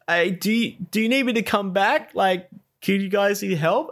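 lightly voiced offer to help; imitating teammate. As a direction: teammate guarding the tank calls over, eager. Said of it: "it's just like".

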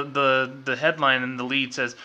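ordinary talk, nothing marked

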